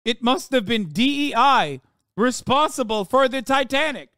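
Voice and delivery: scornful mock voice of a critic; mocking impression. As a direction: sneer it like the people you mock.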